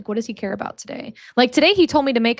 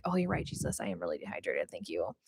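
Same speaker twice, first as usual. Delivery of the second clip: retold in a soft, dropping voice; low, hushed acted reply; meek reply; 13 dB quieter than usual. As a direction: sink into a quiet, sheepish concession. Acting her meek reply to Jesus.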